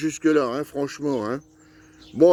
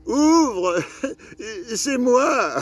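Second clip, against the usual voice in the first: much higher, sing-song comic voice with a laugh; 7 semitones higher, much slower than usual. Retold or imitated. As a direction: ham up a high, goofy rustic voice. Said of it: "elle prend la voix de [name]".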